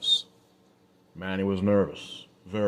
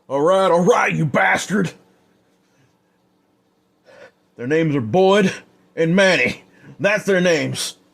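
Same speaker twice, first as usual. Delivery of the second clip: higher and louder, gasping in pain; frantic, hurting surrender; pained, desperate; 9 semitones higher, 6 dB louder than usual. Acted. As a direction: give up the names through agony. Stabbed man gives in under torture.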